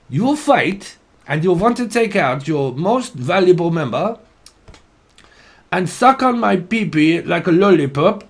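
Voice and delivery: high, baffled mock Russian voice; mock Russian accent. Reader retells a Russian Facebook video, mock Russian accent. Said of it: "it was Russian".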